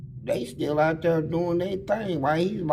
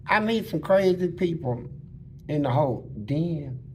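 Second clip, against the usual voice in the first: ordinary delivery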